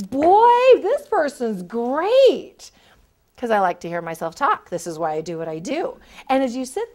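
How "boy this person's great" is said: put-on loud, high boy then a slow gush; delighted recruiter. Recruiter thinks about a talkative candidate, delighted. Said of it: "the more I like you".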